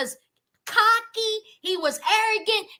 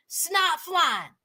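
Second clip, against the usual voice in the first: ordinary talk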